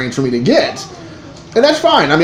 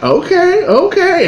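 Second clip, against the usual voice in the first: falsetto okay; slower than usual. Retold or imitated. His baffled reaction.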